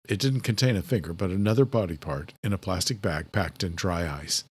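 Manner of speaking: ordinary delivery, nothing marked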